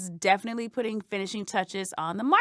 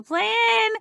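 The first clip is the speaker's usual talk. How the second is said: high anxious plea; 11 semitones higher, 4 dB louder than usual. Acted. Anxious mantra rising in panic.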